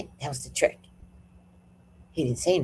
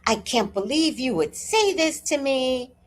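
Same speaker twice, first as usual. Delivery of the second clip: acted meltdown; 6 semitones higher than usual. Imitating the neighbour quitting her, mock meltdown. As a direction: imitate his meltdown, higher and wounded. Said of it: "He had a full meltdown".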